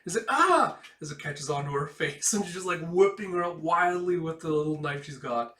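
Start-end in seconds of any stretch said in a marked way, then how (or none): 0.3-0.8 loud, high startled scream; girl screaming as an octopus hits her; high shriek. Acted. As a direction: shriek as it lands on you.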